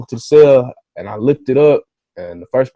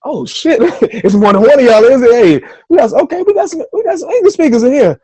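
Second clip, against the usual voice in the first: much higher and louder, laughing excitement; excited disbelief; 13 semitones higher, 7 dB louder than usual. Retold or imitated. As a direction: burst out like you just found your people. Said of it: "I'm like".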